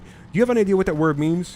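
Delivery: retelling his own baffled comeback; incredulous retort. Retold or imitated. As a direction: fire it back in disbelief. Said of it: "I went".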